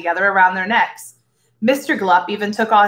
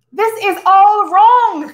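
high, slow, wailing protest; an explorer close to crying, pleading; higher, louder, slow tearful cry; near tears; 11 semitones higher, 7 dB louder, much slower than usual. A narrator's reading. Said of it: "cried Tense, almost in tears".

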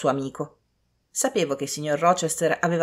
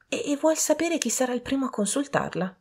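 high, hesitant servant; 9 semitones higher, 3 dB quieter than usual. A narrator's reading. Servant relaying question to guests, high servant voice. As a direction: ask in a higher, deferential servant voice.

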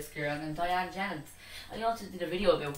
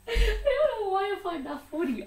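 high-pitched, laughing through the words; 14 semitones higher, 4 dB louder than usual. Real, not acted. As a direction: crack up at your own joke while talking. Cracks up at own joke.

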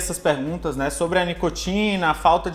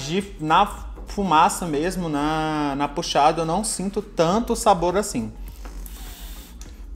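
talking around hookah tip; mouthpiece at lips; slower than usual. Real, not acted. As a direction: lips pressed on pipe. Seen 0: hookah mouthpiece held against lips while he speaks.

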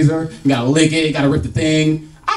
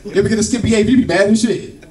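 usual speaking voice

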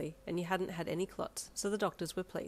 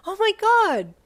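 very high, very loud squeal; ear-splitting thrilled shriek; excited screech; 15 dB louder, slower than usual. A narrator's reading. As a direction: scream it in delight. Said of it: "you screeched".

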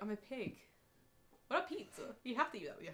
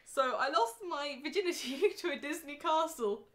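laughing; 10 semitones higher, 9 dB louder than usual. Real, not acted. Embarrassed joke, laughing.

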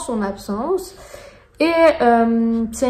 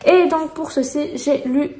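usual speaking voice

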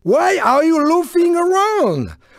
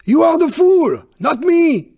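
ordinary talk

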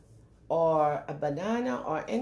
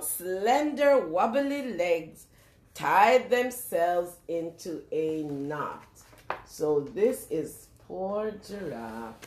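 plain reading voice

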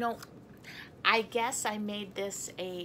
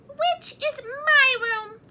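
high, loud, slow mouse; squeaky mouse voice; 9 dB louder, slower than usual. A narrator's reading. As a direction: pipe it like a mouse. Mouse character voice.

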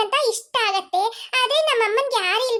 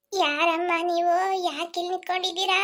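put-on old village woman; 4 semitones lower, 4 dB quieter than usual. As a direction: draw it out like a curious granny. Old village woman questions stranded family.

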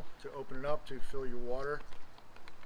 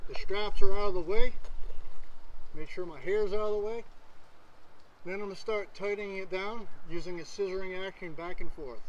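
muffled behind mask; through a gas mask; 4 semitones higher, 7 dB louder than usual. Real, not acted. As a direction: voice through respirator. Seen 1: Gas mask on his face throughout while tightening the straps.

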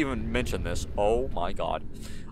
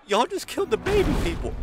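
much higher, squeaky disbelief; shocked squeal; 4 dB louder than usual. Real, not acted. Player sees the little people die, squeals in shock.

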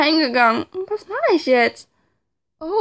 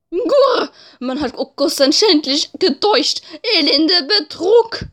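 angry pigeon voice; 3 semitones higher, slower than usual. Acted. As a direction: rage like an offended bird. Voices Oko-san ranting.

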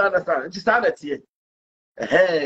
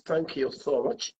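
British thanks; 6 semitones lower, 8 dB quieter, slower than usual. Retold or imitated. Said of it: "This is how we speak in Britain".

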